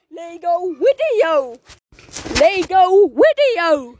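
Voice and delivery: slow, drawn-out show announcement; announcer call. Acted. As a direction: call it out like a TV host. Announcing next section, repeated.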